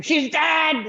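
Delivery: answering a caller with a pretend scream; mock scream. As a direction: yell it into the phone as a joke. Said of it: "I'll just scream".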